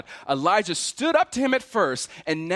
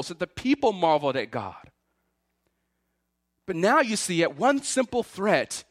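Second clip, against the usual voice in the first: ordinary delivery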